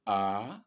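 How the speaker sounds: A held long; A allongé. Shown as a demonstration. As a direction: stretch the vowel A out, "aaa". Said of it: "on va prononcer plus long". (« A » prononcé plus long, voyelle tenue)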